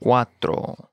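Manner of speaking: "Cuatro" in two slow syllables; slow model. Shown as a demonstration. Teacher modelling vocabulary for learners, slow "Cuatro".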